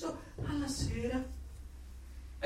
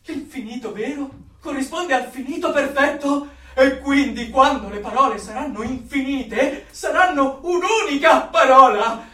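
rapturous; 5 semitones higher, 8 dB louder than usual. Acted. Zealot rhapsodising about infinite words.

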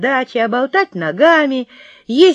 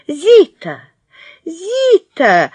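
yelling woman; 7 semitones higher, much slower than usual. Acted. Thin woman runs up yelling for her dog, a high panicked yell. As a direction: yell the dog's name in panic. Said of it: "вопила какая-то тощая гражданка".

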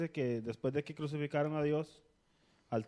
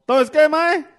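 to someone hard of hearing; 10 semitones higher, 12 dB louder than usual. Real, not acted. Bad phone line, can't hear.

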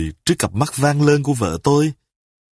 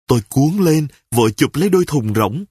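ordinary delivery

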